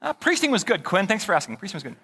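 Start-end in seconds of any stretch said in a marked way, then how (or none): 1.6-2.0 much softer repeat, under the breath; quiet aside. Acted. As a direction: drop it to yourself, softer. Repeats his answer as an aside.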